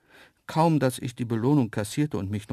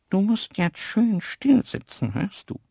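Isabella whispering; 10 semitones higher, 5 dB louder than usual. A narrator's reading. Antelope Isabella telling the lion in the crate to sit still, high and coaxing. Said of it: "wisperte Isabella der Kiste zu".

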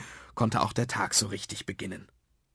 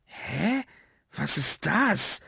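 high, slow, drawn-out guessing; baffled smart aleck; 12 semitones higher, much slower than usual. Acted. Emil puzzles over the metal thing, baffled. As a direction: wonder aloud in a high, stretched voice. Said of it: "gab sich der oberschlaue Emil".

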